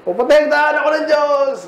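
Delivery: hammed-up shout of faith; mock battle cry. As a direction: pretend charging into war, calling out high. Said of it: "kunwari sa gera".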